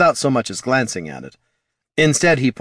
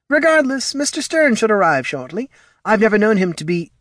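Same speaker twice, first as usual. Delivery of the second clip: high-pitched halfling, businesslike; brisk halfling character; 12 semitones higher than usual. Acted. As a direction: play a halfling in a raised voice. Voices Fitch the halfling.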